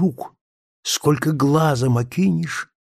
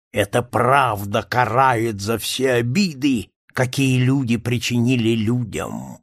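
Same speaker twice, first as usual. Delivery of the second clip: ordinary delivery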